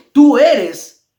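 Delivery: sudden loud cry of the demons; hammed-up loud demon cry. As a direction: shout it like spirits trembling before him. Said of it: "daban voces diciendo".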